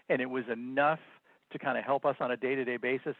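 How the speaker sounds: usual speaking voice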